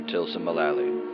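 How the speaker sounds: ordinary delivery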